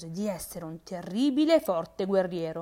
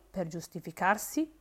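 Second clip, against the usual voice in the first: usual speaking voice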